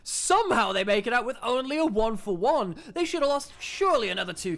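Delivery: loud, high play-by-play yell; excited shouting. Real, not acted. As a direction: shout the escape in disbelief. Caster yelling during escape fight.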